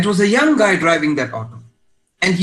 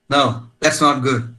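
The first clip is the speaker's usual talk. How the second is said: usual speaking voice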